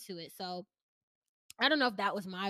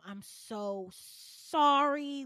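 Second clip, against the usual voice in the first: very slow, louder, tear-soaked apology; tearful apology; 5 semitones higher, 6 dB louder, much slower than usual. Retold or imitated. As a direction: drag the apology out through tears. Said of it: "I'm telling my mom".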